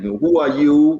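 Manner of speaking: normal talking voice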